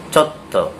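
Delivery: "chotto" with a held double t, cho-tto; chotto pronunciation. Shown as a demonstration. Teacher modelling "chotto" for learners. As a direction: clip the "tt" in "chotto" with a short stop. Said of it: "melafalkan chotto seperti ini ya".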